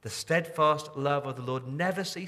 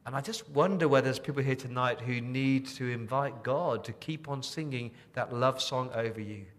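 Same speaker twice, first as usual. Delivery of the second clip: ordinary talk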